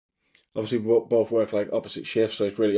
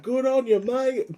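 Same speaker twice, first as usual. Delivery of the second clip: high, squeaky Aussie "Good on you, mate"; mock Australian shark voice; 13 semitones higher, slower than usual. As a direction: chirp it like a cartoon Australian shark. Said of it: "the shark off Finding Nemo".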